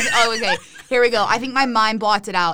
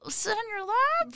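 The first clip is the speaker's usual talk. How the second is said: incredulous; 12 semitones higher, 6 dB quieter, slower than usual. Retold or imitated. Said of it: "I'm just like".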